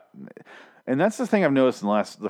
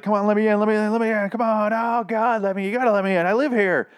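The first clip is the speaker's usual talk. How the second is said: Gregory; 9 semitones higher than usual. Acted. Gregory begging to be let in at the gate, mock desperate. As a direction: whine to be let in, hammed-up. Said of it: "He shows up, and he says".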